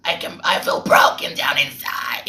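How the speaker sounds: put-on creature voice, mocking; exaggerated golem-like rendering; golem imitation. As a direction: imitate a singer who sounds like a golem. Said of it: "you're sounding like a golem".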